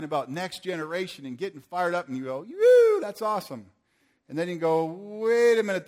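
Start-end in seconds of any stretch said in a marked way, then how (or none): 2.4-3.7 a high, loud mock whoop of joy; excited whoop. Acted listener cheering. 4.9-5.9 sudden doubt. Pretend. Acted listener doubting.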